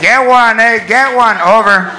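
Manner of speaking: put-on loud Chicano accent over the store speaker; Chicano store announcement. A Home Depot worker announces drills over the store intercom in a Chicano accent. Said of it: "even announcements have accents".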